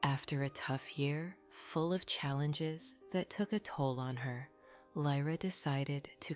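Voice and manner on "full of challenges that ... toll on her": plain reading voice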